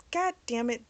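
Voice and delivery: ordinary talk, nothing marked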